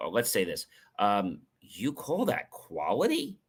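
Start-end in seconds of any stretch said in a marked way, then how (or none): 1.6-3.4 slow, soft, incredulous challenge; a little upset. Shown as a demonstration. Coach questioning the quality of your work, a little upset. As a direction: question it slowly, with quiet disapproval. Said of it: "That's me being a little upset".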